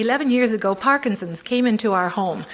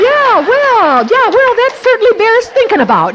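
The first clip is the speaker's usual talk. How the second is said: high loud mock-agreeing; high loud laughing fake agreement; placating agreement; 7 semitones higher, 8 dB louder than usual. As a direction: humor him sweetly, laughing.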